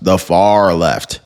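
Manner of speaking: slowed right down, each word weighed; slow emphatic repeat. Real, not acted. Host stressing Crockett's politics to viewers, slow and weighty. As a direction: drag out the far left for effect.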